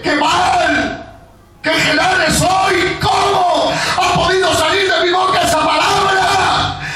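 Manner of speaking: high, shouted sermon delivery; fiery preacher in full flight; full-force preaching. Acted. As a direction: preach it out to the congregation.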